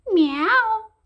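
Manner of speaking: high, loud kitty meow; cat meow. Acted. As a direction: mew like a curious cat. A cat sees the thread.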